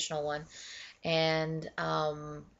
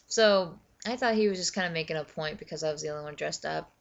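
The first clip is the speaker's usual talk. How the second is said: usual speaking voice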